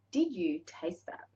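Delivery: slow, soft question; unhurried, voiced and softly wondering; deliberate savouring. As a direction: draw out "Did you taste that?" slowly. Repeats the question more deliberately.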